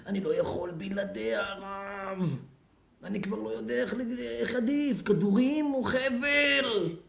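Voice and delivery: drawn-out, whining lovesick wail; hammed-up tearful moan; mock crying. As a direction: imitate a heartbroken man sobbing out his despair. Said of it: "על מה אתה בוכה".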